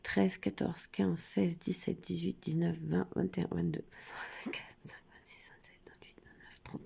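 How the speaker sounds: counting fading to whisper. Real, not acted. Counting her thirty-one colours for viewers, fading to a whisper. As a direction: count on, letting the voice fade from soft to a whisper.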